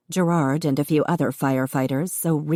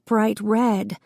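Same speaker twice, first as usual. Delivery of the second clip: ordinary delivery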